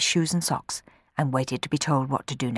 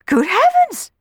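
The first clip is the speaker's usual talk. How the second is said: much higher, louder gasp of surprise; astonished cry; 6 dB louder than usual. Acted. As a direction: cry out in shock. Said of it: "cried Rowan".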